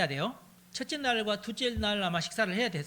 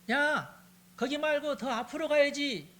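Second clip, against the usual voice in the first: higher, called-out order across the field; calling out; 8 semitones higher, slower than usual. Acted. Jonathan calling the servant to go farther, calling out. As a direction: shout directions to the far-off servant.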